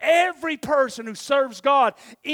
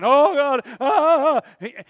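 mock anguished prayer; 4 semitones higher, 9 dB louder than usual.

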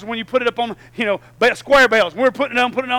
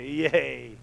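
sarcastic yay; 4 semitones lower than usual. Retold or imitated. Mock cheer at losing swim.

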